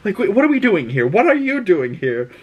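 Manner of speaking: high, fast incredulous questions; baffled. Retold or imitated. Alicent, retold, startled to find Rhaenyra there, incredulous. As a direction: ask it like you can't believe who's standing there. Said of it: "She's like".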